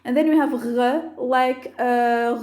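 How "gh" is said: "r" made at the back of the throat; French-style r. Shown as a demonstration. Teacher likening it to French, "r" said uvular. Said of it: "r in French".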